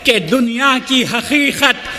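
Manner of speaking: measured, rhythmic mushaira delivery; stately nazm recitation. Acted. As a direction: recite each couplet with gravity.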